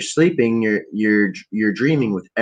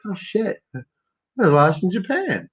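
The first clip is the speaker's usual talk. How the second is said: waking realization; 8 semitones higher, slower than usual. Retold or imitated. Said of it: "I woke up and I was like".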